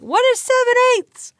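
high, loud disbelief at seven-eighths; incredulous. Real, not acted. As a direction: squeal the question in disbelief. Incredulous question about fraction.

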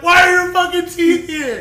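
high, loud exasperated yell; mock exasperated yell. Calling his friend about the teeth, loud exasperated yell. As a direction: yell it down the phone. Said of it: "I'm like".

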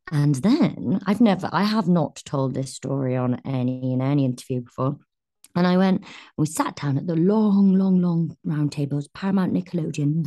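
ordinary delivery

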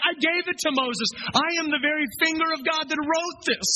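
high, declaring voice of Jesus; voicing Jesus. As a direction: speak as Jesus claiming authorship. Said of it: "He's like".

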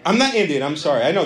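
ordinary delivery

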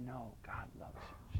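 whispered gently, unvoiced and soft. Preacher whispering to the prostitute, soft whisper. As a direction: hush it like a secret comfort.

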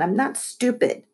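snippy. A narrator's reading. Caitlin defending herself to Lexi, snippy. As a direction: snip back in self-defence. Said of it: "snipped Caitlin".

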